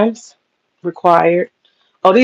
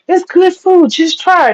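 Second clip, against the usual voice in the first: begging; 9 semitones higher than usual. Retold or imitated. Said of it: "They was, like, begging her".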